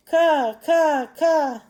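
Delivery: high, loud triple caw. Acted. Mother crow calling from high above, loud repeated caws. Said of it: "That's why I said it a little bit louder".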